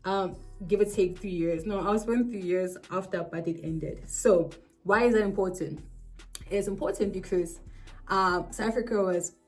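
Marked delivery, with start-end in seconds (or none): none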